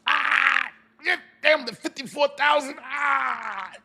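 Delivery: anguished cry. Real, not acted. Recalling losing fifty-four thousand.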